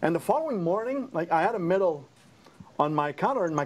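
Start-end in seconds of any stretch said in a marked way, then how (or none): none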